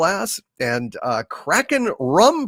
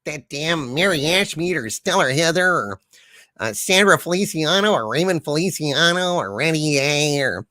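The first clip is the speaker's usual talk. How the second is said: a lightly voiced blustering villain, mostly defiant; Harvey character voice; 3 semitones higher than usual. Harvey, stuck behind a force field, refuses to enter the chat, lightly voiced blustering villain. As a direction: play the boastful villain trapped in his field, refusing the chat.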